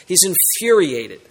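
ordinary talk

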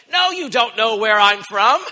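slow, loud firm denial; expected retort. Acted. What Jesus might have told the crowd, loud slow denial. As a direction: deny it emphatically, slowly. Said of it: "You might have expected him to say".

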